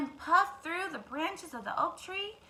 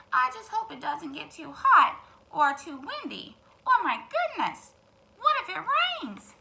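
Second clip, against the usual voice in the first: worried hen; 4 semitones higher, 6 dB louder than usual. Acted. Worried hen voiced in story.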